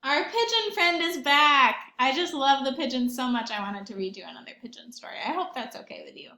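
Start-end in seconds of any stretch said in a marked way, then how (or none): none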